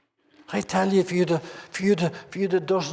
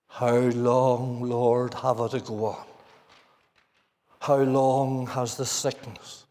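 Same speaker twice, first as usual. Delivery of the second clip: weary low plea; 9 semitones lower, slower than usual. Retold or imitated. A tired believer pleading with God, low and slow. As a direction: lower and slow, a worn-out sufferer praying. Congregation's weary prayer voiced.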